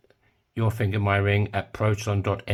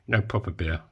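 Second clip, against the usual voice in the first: ordinary talk, nothing marked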